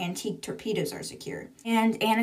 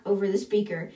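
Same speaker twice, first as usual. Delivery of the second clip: ordinary delivery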